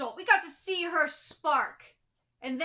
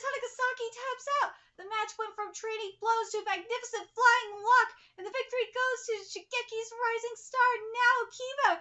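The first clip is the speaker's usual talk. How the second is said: ringside announcer; 8 semitones higher than usual. Acted. Reading the match announcer.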